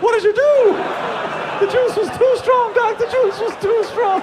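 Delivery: piercingly high, loud falsetto; hammed-up panicked falsetto; changed voice. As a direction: wail in a put-on squeak. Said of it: "Is my voice gonna change?".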